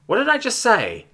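snapped. A narrator's reading. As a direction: snap it like a scolding. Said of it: "Iava snapped".

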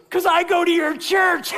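high, loud gleeful reveal; punchline shout. Retold or imitated. Sheriff's punchline, acted.

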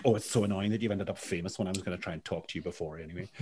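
put-on a rough, fast stab at a Northern Irish accent, apologised for afterwards; hammed-up Northern Irish lilt, rattled off fast; terrible Northern Irish accent. Podcast guest imitating his Northern Irish friend Mark, in a bad Northern Irish accent. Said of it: "for my terrible Northern Irish accent".

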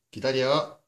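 loud, firm wait command; firm dog commands. Real, not acted. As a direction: order the dog to hold.

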